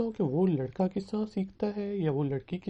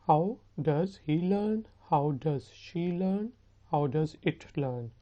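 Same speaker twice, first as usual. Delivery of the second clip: measured drill, word by word; slow model questions; much slower than usual. Shown as a demonstration. Teacher drilling he, she, it questions, slowly. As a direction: lay out each form slowly.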